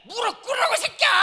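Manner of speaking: very high, very loud roar; hammed-up screaming fit; furious shout. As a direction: scream the order like an enraged parent. Parent roaring at kneeling son.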